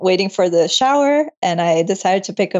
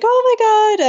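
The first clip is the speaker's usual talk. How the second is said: sweet excited gasp; 14 semitones higher, faster than usual. Retold or imitated. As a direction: gush like a sweet stranger sharing the joy. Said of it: "cute and sweet and was like".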